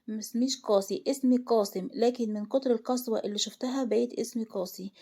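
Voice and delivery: usual speaking voice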